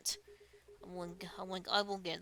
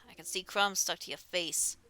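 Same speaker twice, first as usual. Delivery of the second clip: ordinary delivery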